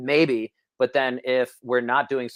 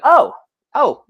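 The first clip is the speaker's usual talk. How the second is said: sharp high loud Oh, dropping to a second oh; stunned realization; 11 dB louder than usual. Retold or imitated. Son realizing his father is dead, startled high oh. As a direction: it suddenly dawns on you, voice jumps up.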